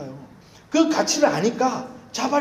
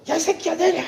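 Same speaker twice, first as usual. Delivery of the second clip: high, sharp mock yell at the taxi driver; retold angry yell; 11 semitones higher, 3 dB louder than usual. Pastor reenacting yelling at a taxi, mock angry shout. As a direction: bark it like a road-rage driver. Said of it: "제가 어떻게 했겠어요?".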